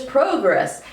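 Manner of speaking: "progress" as PROH-gress, long o stressed; first-syllable stress. Shown as a demonstration. Lecturer comparing variants of the word, first-syllable stress. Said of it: "emphasis on the first syllable".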